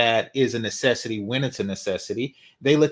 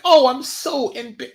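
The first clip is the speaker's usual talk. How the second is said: higher, slower whiny falsetto; mock fussy woman; 10 semitones higher, 3 dB louder, slower than usual. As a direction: cut off mid-whine. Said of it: "without just getting like".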